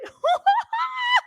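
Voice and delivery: high shrieked laugh of pure delight; shrieking laugh. Real, not acted. Host shrieking with joy at the chart, laughing. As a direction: let out a squealing laugh. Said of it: "I'm freaking out".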